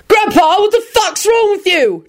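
high, outraged yell; indignant high-pitched shout. A narrator's reading. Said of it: "Jamie yelled".

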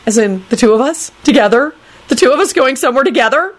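high, disbelieving questions; high-pitched squeak. A narrator's reading. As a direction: squeak in growing alarm. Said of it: "squeaked out in a high-pitched voice".

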